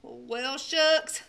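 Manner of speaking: ordinary delivery, nothing marked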